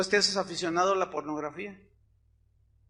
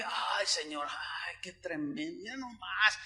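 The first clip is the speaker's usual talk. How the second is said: hushed awe; 2 semitones lower, 9 dB quieter, slower than usual. Retold or imitated. Awed at the sunrise.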